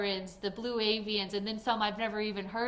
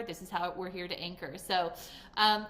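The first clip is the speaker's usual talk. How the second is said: ordinary talk, nothing marked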